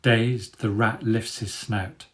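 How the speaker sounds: usual speaking voice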